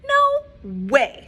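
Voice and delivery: loud firm no way; firm refusal. Acted. Farmer Brown refuses the cows, loud and firm. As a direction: shut it down flat out. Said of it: "said Farmer Brown".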